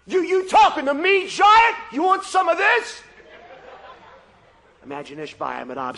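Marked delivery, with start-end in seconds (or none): 0.1-3.0 loud, high, swaggering taunt; cocky challenger. Acted. Young Abishai taunting the giant, loud and cocky. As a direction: taunt him like a street fighter.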